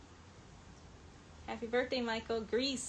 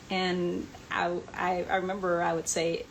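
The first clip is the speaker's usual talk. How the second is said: ordinary talk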